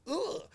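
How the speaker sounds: high-pitched "ugh" of mock disdain; mock grunt. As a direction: grunt it up high, imitating scorn. Mocks how kids get labelled.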